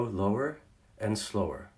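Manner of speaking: soft, unhurried demo of calm speech; a calm voice shown low and slow. Shown as a demonstration. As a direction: drop it down and take your time. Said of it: "their voice gets faster, louder, and higher".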